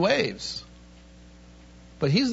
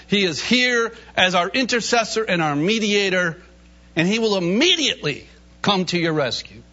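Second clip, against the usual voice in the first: ordinary talk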